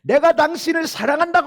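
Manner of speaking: high, loud yell of love; shouted at wife. Retold or imitated. Husband shouting at his wife in a quarrel, loud yell. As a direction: yell it out in frustration. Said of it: "막 소리를 질렀어요".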